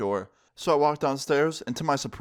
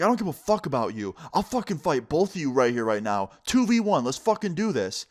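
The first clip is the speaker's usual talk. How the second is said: higher-pitched, heated imitation of a furious dad; snapping in anger; 4 semitones higher than usual. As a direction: challenge them both to a fight, losing it. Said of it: "just snaps".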